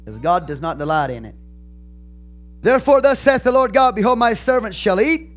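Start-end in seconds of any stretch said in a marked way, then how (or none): 2.6-5.3 loud, high, heated pulpit voice; fired-up preaching. Acted. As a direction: lift the congregation with rising fire. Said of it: "I start talking like this".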